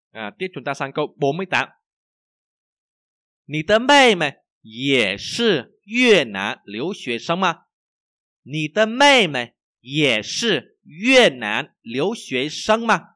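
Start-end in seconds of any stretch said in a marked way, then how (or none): 2.6-13.2 very slow, loud, word by word; language teacher's model reading. Shown as a demonstration. Teacher reads for learners to repeat, slow model reading.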